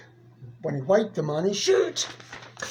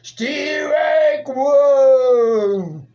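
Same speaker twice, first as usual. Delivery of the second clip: loud umpire call, pitched higher; umpire's call; 4 semitones higher, 4 dB louder than usual. Acted. Umpire announcing the first strike to the crowd, loud higher call. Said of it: "the umpire said".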